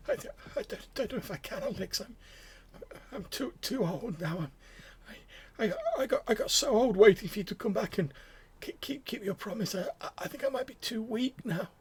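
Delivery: put-on high, stammering old man's plea; frail old man. Old patient confesses weakness to the visitor, frail plea. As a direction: quaver it out, too weak to go on.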